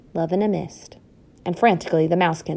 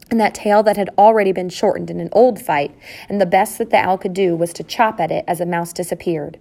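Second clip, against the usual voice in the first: ordinary delivery, nothing marked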